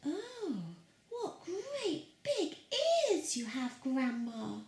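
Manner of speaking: put-on high, slow and giggly girl; giggly little girl in awe. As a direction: wonder at it like a tiny girl, giggling. Girl voiced in the story.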